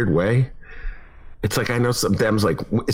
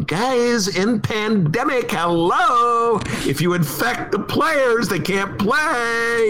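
exasperated high voice; 12 semitones higher, much slower than usual. Acted. High exasperated voice about fans.

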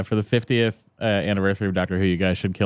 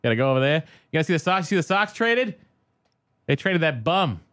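Boston accent; 7 semitones higher, faster than usual. Shown as a demonstration. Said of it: "do the Boston accent".

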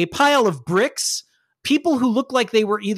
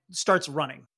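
ordinary delivery

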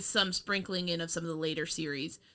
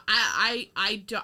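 ordinary delivery

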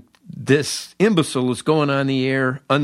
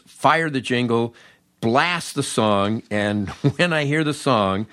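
ordinary talk

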